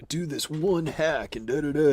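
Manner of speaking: high hyped-up YouTuber pitch; mock clickbait tutorial host; YouTube tutorial voice. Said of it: "every YouTube mixing channel that's like".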